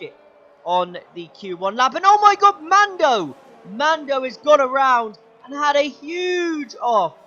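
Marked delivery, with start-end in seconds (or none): 2.0-7.1 loud, high burst of shock at the crash; excited shout. Real, not acted. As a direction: yell it out as the car flies off. Crash seen live in race.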